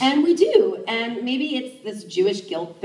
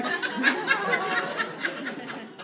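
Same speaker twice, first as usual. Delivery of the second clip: breathy laugh; 12 semitones higher, 5 dB quieter than usual. Real, not acted. Breathy laugh after punch.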